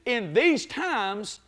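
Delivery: building sermon cadence; preaching build. Acted. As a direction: climb toward the shout.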